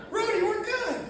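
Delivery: light teasing impression; imitating Tim. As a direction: sweet-talk Rudy in a high voice. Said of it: "And Tim's like".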